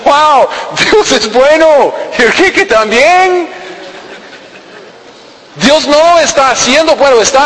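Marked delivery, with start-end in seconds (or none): 0.0-3.5 delighted gentile. Retold or imitated. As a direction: rave about the free cake.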